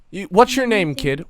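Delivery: pressing question. Acted. Tough woman questioning a kid, insistent but not shouting.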